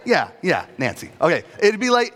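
ordinary talk, nothing marked

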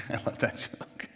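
chuckling through the words; amused at their own joke; real laugh while talking. Real, not acted.

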